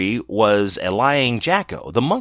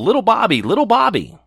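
high calling voice; 10 semitones higher than usual. Acted. Dream voices calling Bobby.

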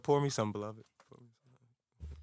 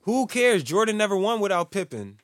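high, loud heated point; 6 semitones higher, 5 dB louder than usual. Real, not acted. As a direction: argue it hard. Heated argument.

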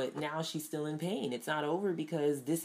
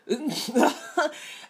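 rising high laughter, louder; 11 semitones higher, 8 dB louder than usual. Real, not acted. Laughing at Jennifer's blunt goodbye, high and loud. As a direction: let out a high delighted laugh.

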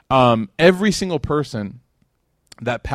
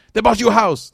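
high, louder made-up announcement; mock excited claim; 10 semitones higher, 6 dB louder than usual. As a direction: blurt it out like big invented news. Mock example of making something up.

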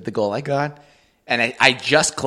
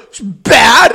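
higher, loud burst; mock upset outburst; 10 semitones higher, 11 dB louder than usual. Upset kid answers dad after reffing, loud mock outburst. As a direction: blurt it like a sulking kid.

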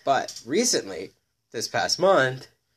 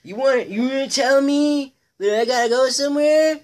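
put-on angry drunk lady; 8 dB louder than usual. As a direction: shout it like a drunk lady defying cops. Voicing the arrested lady.

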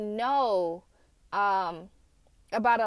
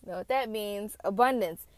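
ordinary talk, nothing marked

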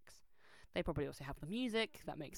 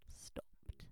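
stopped breathed out in a whisper; 11 dB quieter than usual. Real, not acted. Telling how the church band quietly stopped, whispered. Tale of song sheets stopping.

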